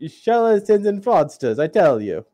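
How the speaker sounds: put-on gruff Italian accent, drifting Russian; angry Italian accent. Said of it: "an angry Italian accent".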